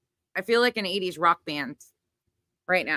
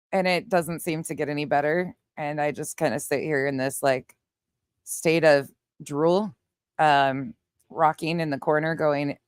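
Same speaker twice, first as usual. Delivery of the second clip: normal talking voice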